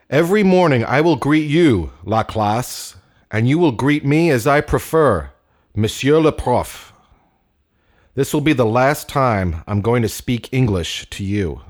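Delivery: pompous teacher's announcement; old French teacher. Acted. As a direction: lay down the rules to the class. Teacher lays down rules.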